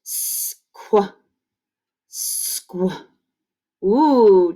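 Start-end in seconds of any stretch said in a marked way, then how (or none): 0.0-3.1 whispered "Squa" with sounds pulled apart; slow blend demo. Shown as a demonstration. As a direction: breathe out "Squa" slowly, one sound at a time. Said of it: "I'm gonna slow down a little bit".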